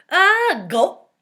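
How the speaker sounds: high, loud startled yell. A narrator's reading. Jason falling off the chair, a loud startled yell. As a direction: yelp it out as the chair tips. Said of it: "yelled Jason".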